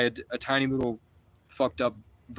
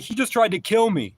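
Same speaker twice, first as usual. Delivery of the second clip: much higher, loud, panicked yell; retold yell; 8 semitones higher, 4 dB louder than usual. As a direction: cry it out to the driver. Yelling at the driver.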